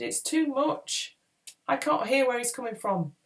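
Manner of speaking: high-pitched, strained protest; shouting. A narrator's reading. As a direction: call it out over the din. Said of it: "Crom shouted".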